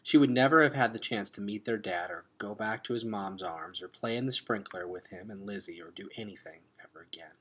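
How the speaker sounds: ordinary delivery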